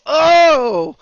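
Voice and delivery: sudden high loud "Oh!"; mock-startled cry; startled yelp. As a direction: yelp as the cramp hits. Retelling sudden gut pain.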